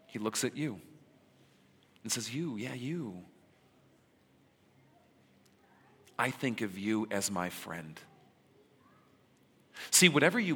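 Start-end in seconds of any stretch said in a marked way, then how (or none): 2.3-8.0 soft, close, almost confiding; hushed and personal, picking out a single listener; intimate address. Retold or imitated. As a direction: lean in and say it just to one person. Jesus addresses the listener directly.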